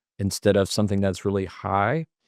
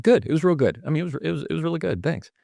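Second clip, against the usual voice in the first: higher, stumbling, tossed-off reply; pitched-up babbled pleasantry; hurried, unthinking answer; 8 semitones higher, much faster than usual. Shown as a demonstration. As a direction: answer too quickly, tripping over yourself. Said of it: "There's a difference".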